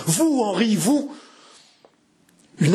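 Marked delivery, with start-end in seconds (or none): 0.1-1.1 high-pitched, appalled exclamation; horrified cry. A narrator's reading. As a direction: cry out in dread, pitch leaping up. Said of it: "Jeanne eut un cri épouvantable".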